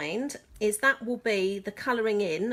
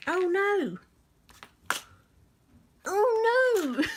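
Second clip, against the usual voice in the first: much higher, louder cry of dismay; dismayed surprise; 9 semitones higher, 4 dB louder, much slower than usual. Real, not acted. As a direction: realise the mistake mid-sentence. Realizes papers are wrong way.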